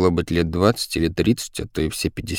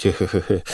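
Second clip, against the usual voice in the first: weak, joking he-he laugh; thin attempt at laughter; forced chuckle. A narrator's reading. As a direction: force out a small nervous chuckle. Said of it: "попробовал пошутить".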